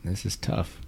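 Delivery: quiet. Real, not acted. Quiet verdict on the beer.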